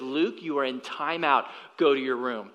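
low, soft and matter-of-fact; quietly firm parent imitation; calm. Wife calmly sending their son to his room, calm. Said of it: "in a calm voice".

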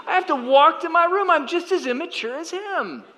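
very high, incredulous self-ridicule; comic self-mockery. Father realizing he is as immature as his son, comic self-mockery.

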